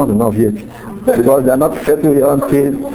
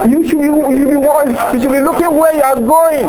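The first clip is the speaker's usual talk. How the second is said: high-pitched, mock-indignant comeback; quarrelling retort; 10 semitones higher than usual. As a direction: answer back in a raised, much higher pitch. Acted quarrel, brother answers back.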